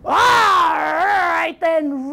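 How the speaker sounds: long growing roar of grudging consent; troll's roar. Acted. Troll letting the little goat go, roaring. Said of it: "roared the troll".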